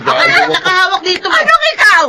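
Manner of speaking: shrill furious shouting over the bananas; snatching the bananas back and shouting; angry shout. Acted. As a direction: yell to claim the bananas, high and loud.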